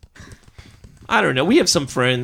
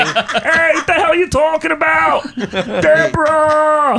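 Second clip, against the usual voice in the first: Ray Romano impression; 9 semitones higher, slower than usual. Shown as a demonstration. Demoing Ray Romano, shouting for his wife Deborah. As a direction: yell for Deborah like an exasperated sitcom husband. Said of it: "It's like Ray Romano".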